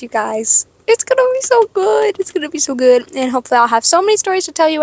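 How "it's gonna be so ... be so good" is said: high-pitched excited gush; giddy excitement. Real, not acted. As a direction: gush it high, full of anticipation. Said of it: "I'm so excited".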